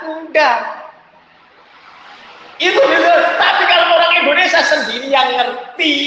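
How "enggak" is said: ordinary delivery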